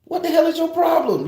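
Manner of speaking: high yell back at a heckler. Retold or imitated. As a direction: shout it at a stranger far off. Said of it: "I'm yelling back and forth".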